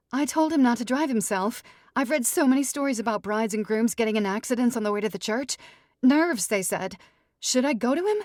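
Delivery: high, loud, rushing worried chatter; self-absorbed bride spiralling out loud; panicky bride. Acted. Ruby's anxious bride voice.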